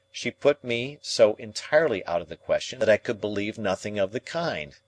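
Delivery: usual speaking voice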